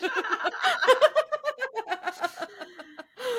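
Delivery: big high-pitched laugh. Real, not acted. Cohost laughing at her own joke, high laugh. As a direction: let a shrieking laugh out.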